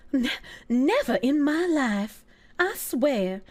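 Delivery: slow, drawn-out Southern vow; mock Southern belle swearing innocence; Southern belle accent. Streamer joking about mint juleps, slow Southern belle accent. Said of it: "it goes with that accent".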